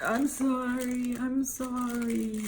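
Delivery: slow, drawn-out mock apology in a put-on kid voice; imitating son. Mother imitating her son's apology, slow put-on whiny voice. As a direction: drag out a whiny little sorry. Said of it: "And he was like".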